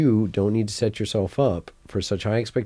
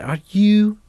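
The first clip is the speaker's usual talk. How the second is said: put-on Scottish accent; 11 semitones higher, 5 dB louder than usual. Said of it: "I'm doing the Scottish".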